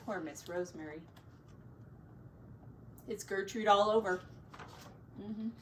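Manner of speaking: ordinary delivery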